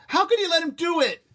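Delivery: high, loud accusing cry; upset outburst. Acted. Son crying out at his mother, loud. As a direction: blurt it out in distress.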